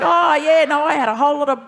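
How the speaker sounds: mock the farmer's breezy chatter; cheery old farmer. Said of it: "It was like".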